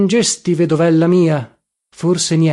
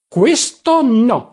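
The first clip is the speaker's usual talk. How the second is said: sharp high protest, each word drawn out; snapped outburst; 7 semitones higher, 4 dB louder, much slower than usual. A narrator's reading. Mother-in-law objecting to the visits, snapped and indignant. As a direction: snap a firm, offended refusal. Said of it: "scattò la Pescatore".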